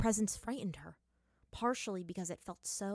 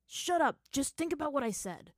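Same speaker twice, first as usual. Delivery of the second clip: higher, louder cut-off; irritated; 7 semitones higher, 4 dB louder than usual. Acted. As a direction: shut the argument down with irritation. Snapping at his younger self.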